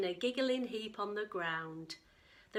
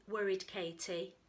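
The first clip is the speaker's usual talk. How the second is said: ordinary delivery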